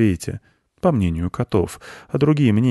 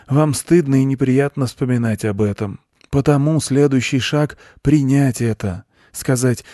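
raised, earnest lecturer's voice; preaching goat; 7 semitones higher, 4 dB louder than usual. A narrator's reading. Goat explains his doctrine to a boy, preaching. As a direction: expound it like a guru, pitched up.

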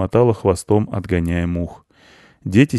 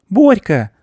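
put-on high, louder child's exclamation; girl guessing; 12 semitones higher, 5 dB louder than usual. A narrator's reading. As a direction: call out the guess like a little girl. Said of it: "догадалась Аня".

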